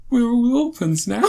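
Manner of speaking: high, half-laughing imitation of a sob; hammed-up crying mum; mock tearful wail. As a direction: wail it like a grieving mum while cracking up. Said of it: "in a flood of tears".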